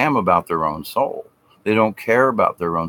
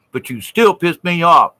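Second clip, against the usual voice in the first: higher, louder petulant whine; mock grudge-holder; 8 semitones higher, 5 dB louder than usual. Mocking a grudge-holder, petulant and louder.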